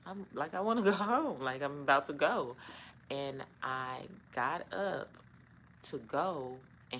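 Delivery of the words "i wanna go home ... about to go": laughing exasperated. Retold or imitated. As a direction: laugh it off while insisting. Retold wanting to leave.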